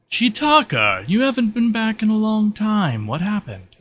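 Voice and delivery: high, loud, sing-out welcome; animated greeting in character. Acted. Game master voices stage character.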